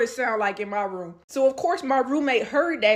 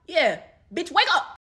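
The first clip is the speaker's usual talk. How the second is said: louder and higher, snapping him awake; retold angry wake-up; 6 semitones higher, 4 dB louder than usual. Reenacting waking the cheating boyfriend, angry and loud. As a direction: toss it at him and demand he wake up.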